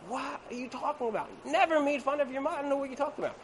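high, soft mock protest; incredulous wife. Wife denying she mocked his mother, high soft indignation. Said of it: "And she's like".

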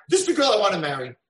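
loud, fast declaration; proud declaration. Acted. Hashem telling the angels his choice, loud and fast. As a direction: announce it with joy. Repeated on Pesach night.

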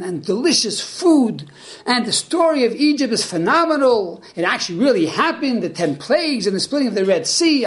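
high, eager voice full of wonder; excited simpleton. Acted. Simpleton at the Seder, amazed, gushing excitedly. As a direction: gush like a wide-eyed newcomer at his first Seder. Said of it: "He's all excited".

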